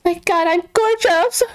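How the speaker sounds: high, playful self-admiring gush; mock vain. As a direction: swoon over her own reflection, tongue in cheek. Admiring her avatar in mirror.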